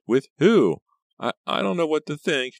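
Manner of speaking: high, flustered stammer. A narrator's reading. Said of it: "Pam stammered".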